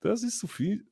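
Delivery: high mock accent mixing Spanish into German; Spanish-flavoured German. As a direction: stumble into a Spanish word mid-German sentence. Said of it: "accents in other languages".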